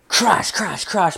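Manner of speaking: high-pitched crash noises; quick high bursts like things smashing; imitated crashing. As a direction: mimic the racket of a brawl.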